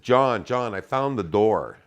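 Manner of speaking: loud calling across the cabin. Retold or imitated. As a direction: call to him loudly. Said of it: "And I said".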